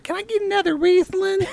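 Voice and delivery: high, slurred plea for another glass; slurry tipsy mom. Retold or imitated. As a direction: slur it like a tipsy mother. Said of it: "she gets a little slurry".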